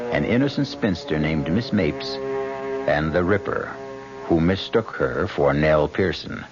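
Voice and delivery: usual speaking voice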